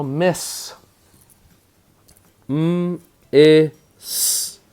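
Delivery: "Miss" whole, then "M, I, S" as high, separate sounds; sounded out. Shown as a demonstration. Teacher helps spellers, sound by sound. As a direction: split "Miss" into hummed m, short i, hissed s. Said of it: "Syllable break aparts".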